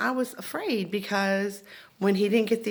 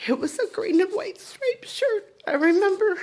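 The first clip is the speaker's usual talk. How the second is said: high, emotional voice on the verge of tears; real tearful recall; 13 semitones higher, 4 dB louder than usual. Real, not acted. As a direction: choke up as the memory comes back. Tearfully recalls being shot.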